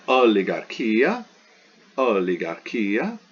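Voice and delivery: "oligarchia" slowly as o-li-gar-KI-a; Greek word demonstration. Shown as a demonstration. Teacher models a Greek word for students, slow "o-li-gar-KI-a". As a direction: stretch "oligarchia", lifting at the end.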